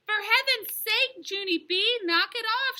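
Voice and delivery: loud, high, exasperated scolding; yelling. A narrator's reading. Mother grabbing clown girl by pants, yelling. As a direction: yell it, stretching the opening. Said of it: "she yelled".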